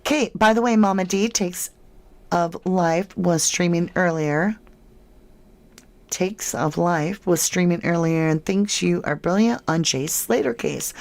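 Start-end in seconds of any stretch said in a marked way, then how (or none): none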